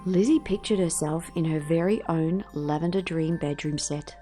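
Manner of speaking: ordinary delivery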